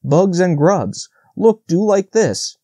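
high, slow, playful demonstration; amused. A narrator's reading. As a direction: show off, drawing the words out. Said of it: "said the woodpecker with a laugh".

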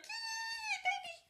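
high, loud excited squeal; retelling her own joyful squeal; squealing yay. Retold or imitated. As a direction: squeal with delight like a kid. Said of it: "I'm like".

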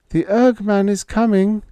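put-on high, loud, trembling call of a girl; quavering call. A narrator's reading. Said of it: "the girl called".

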